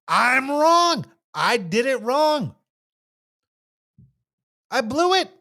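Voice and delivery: "I'm wrong" high and drawn out, emphatic; emphatic admission. Shown as a demonstration. Owning up to a mistake, emphatic admission. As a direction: own up to "I blew it" in a high, firm voice. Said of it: "Emphatically admit it".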